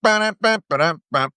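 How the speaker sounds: sung-out comedy drum sting, starting high; mock drum sting after a punchline; mimicked rimshot. Shown as a demonstration. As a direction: punctuate a joke with a vocal rimshot. Mimes a rimshot after the joke.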